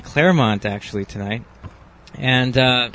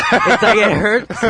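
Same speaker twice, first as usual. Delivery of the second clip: laughing; 7 semitones higher, slower than usual. Real, not acted. Cold beer hurts, laughing.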